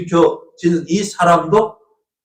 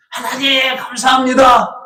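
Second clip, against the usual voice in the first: shouted praise; 10 semitones higher, slower than usual. Retold or imitated. Healed man praises God aloud, shouting joyfully. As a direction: cry out thanks to God with joy. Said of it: "하나님을 찬양해".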